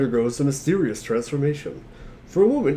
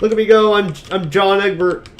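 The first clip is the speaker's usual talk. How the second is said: higher and louder, thrilled to be moving; gleeful as the character walks; giddy play-along cheer; excited gamer; 6 semitones higher, 7 dB louder than usual. Real, not acted. Playing the Flash game excitedly.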